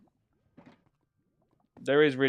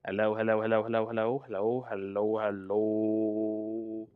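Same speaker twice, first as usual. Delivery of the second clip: monotone chain of hellos, last ones stretched; mic check. Real, not acted. Streamer testing voice level, droning mic-check hellos. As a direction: drone hellos into the mic, drawing out the end.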